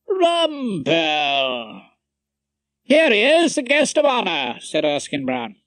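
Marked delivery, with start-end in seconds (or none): 0.1-1.8 long, drawn-out welcoming call. A narrator's reading. Head of chambers greeting his guest, welcoming. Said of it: "stepped forward with a welcoming". 2.8-4.6 high, loud welcome; hearty announcement. A narrator's reading. Colleague announcing Rumpole to the party, hearty.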